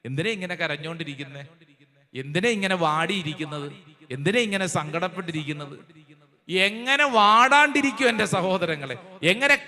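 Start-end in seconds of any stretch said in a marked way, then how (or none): 0.1-5.8 gentle comforting God. Acted. God gently asks weeping Moses.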